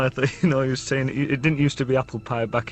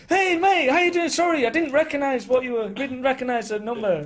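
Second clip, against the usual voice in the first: much higher, flustered, tumbling greeting; star-struck squeak; 12 semitones higher, 4 dB louder than usual. Retold or imitated. Singer realising [name] is calling, squeaky and flustered. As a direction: voice jumps way up in starstruck panic. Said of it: "and I went".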